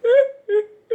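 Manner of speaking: half-laughing fake sob; mock crying through a laugh; mock boo-hoo crying. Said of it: "boo-hoo cried".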